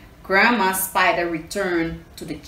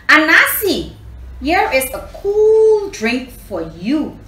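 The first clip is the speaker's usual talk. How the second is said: calling out; 8 semitones higher, 7 dB louder than usual. Acted. Grandma calls Anansi from porch.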